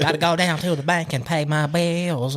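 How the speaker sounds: high mock Southern twang; Southern accent. Friend parodying Tennessee cousins, exaggerated Southern accent. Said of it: "that's quite the accent".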